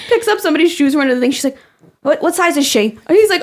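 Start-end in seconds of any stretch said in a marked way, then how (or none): 2.0-3.0 higher, imitated Long Island voice; Long Island accent. Podcast host imitating worker holding shoes, higher Long Island accent. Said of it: "the most, like, Long Island people ever".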